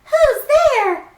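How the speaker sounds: high, loud squeaky mouse; startled mouse cry. Acted. Mouse hearing someone enter the den, high startled squeak. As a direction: squeak out in alarm. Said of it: "Mouse cries".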